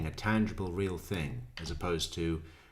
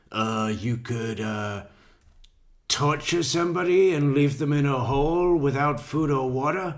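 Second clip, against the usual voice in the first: loud, higher hammed-up suggestion; theatrical mock suspect; 6 semitones higher, 7 dB louder than usual. Mocking the suspect's answer to the detective, hammed-up and loud. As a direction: ham it up like he's pitching torture as an idea. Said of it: "a little bit more theatrical than we were going for".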